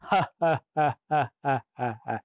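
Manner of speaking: long, evenly pulsed villain cackle; theatrical monster guffaw; menacing roaring laugh. A narrator's reading. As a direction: laugh like a story demon, beat by beat. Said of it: "खतरनाक अट्टहास".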